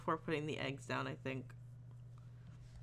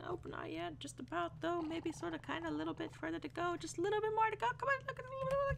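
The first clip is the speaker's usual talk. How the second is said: very high voice climbing higher and speeding up into babble; silly squeaky patter; 11 semitones higher, 4 dB quieter than usual. Pretend. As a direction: start high, then wind up into squeaky gibberish. Playful babble while waiting on egg.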